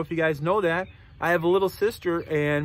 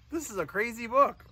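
usual speaking voice

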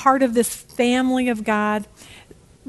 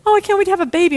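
put-on high, louder gushing kid; mock-excited, pitched up; imitated eagerness; 8 semitones higher, 5 dB louder, much faster than usual. Imitating her eager kids.

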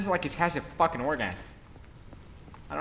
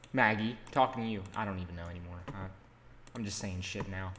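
ordinary talk

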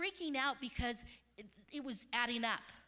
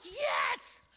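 a sudden higher shout of yes; joyful yes; 8 semitones higher, 4 dB louder than usual. Retold or imitated. Retelling the moment the gift arrived, excited shout. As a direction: cry out in thanks. Recalling joy when money came.

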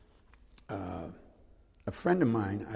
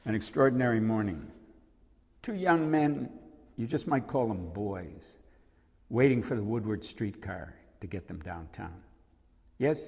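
lightly voiced poem reading, a touch more lift; 3 dB louder than usual. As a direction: read the poem's opening plainly but with care. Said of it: "Could you read the beginning of that?".

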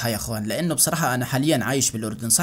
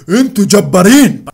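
hyped shout; 5 semitones higher, 12 dB louder than usual. Real, not acted. Praising the huge fan base.

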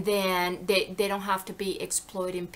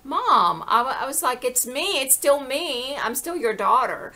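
louder, higher retold plea to mom; retold protest; 5 semitones higher, 8 dB louder than usual. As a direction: plead your case as a daughter.

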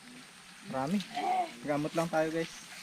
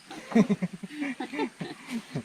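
laugh; 9 semitones higher than usual. Real, not acted. Laughing about sneaking past owner.

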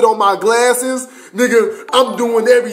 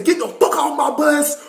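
high-pitched mock yell; mock command, pitched well up; retold yelled order; 8 semitones higher than usual. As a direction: order them off, imitating a yelling instructor. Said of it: "they yelling at me".

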